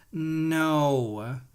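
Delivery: ordinary talk, nothing marked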